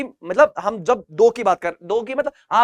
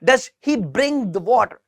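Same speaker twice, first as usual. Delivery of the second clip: slow model answer; much slower than usual. Shown as a demonstration. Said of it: "तो कैसे करेंगे?".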